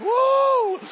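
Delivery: high, loud joyful whoop; preacher's whoop of joy; exultant whoop. Real, not acted. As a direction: let out a cry of triumph. Preacher's exultant cry mid-sermon.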